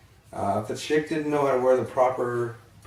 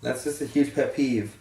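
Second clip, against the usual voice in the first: ordinary delivery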